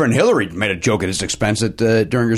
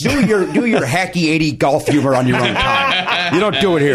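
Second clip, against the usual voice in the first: mock outrage; 6 semitones higher than usual. Mock outrage at golf joke.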